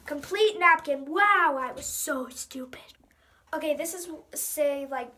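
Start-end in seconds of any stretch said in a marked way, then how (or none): 1.6-2.9 self-mocking whisper. Real, not acted. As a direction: whisper it to yourself. Cringing at old self.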